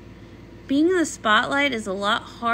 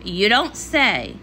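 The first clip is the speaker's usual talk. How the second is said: sarcastic; 3 semitones lower, slower than usual. Acted. Dry reply to bad news.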